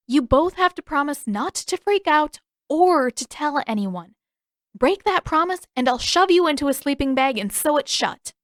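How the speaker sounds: a lightly voiced stern Katara. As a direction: warn them with playful menace.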